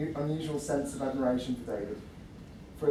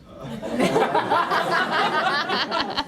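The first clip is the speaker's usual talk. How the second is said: burst of laughter; 13 semitones higher, 6 dB louder than usual. Real, not acted. Breaks down laughing.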